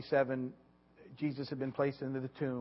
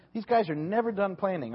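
normal talking voice

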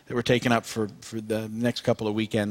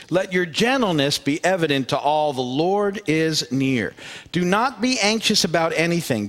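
raised, solemn reading voice, slowing at the close; scripture reading; 4 semitones higher than usual. Acted. Pastor reading Philippians to the congregation, raised and solemn. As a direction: lift each line to the room.

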